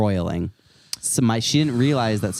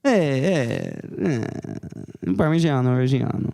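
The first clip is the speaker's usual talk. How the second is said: put-on Italian accent; 2 semitones higher, slower than usual. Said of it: "I like that accent".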